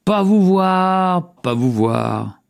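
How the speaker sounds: slow, drawn-out echo; voix en écho. A narrator's reading. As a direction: echo it high, then drop back as it fades. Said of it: "Et les voix répondirent". (écho étiré qui retombe)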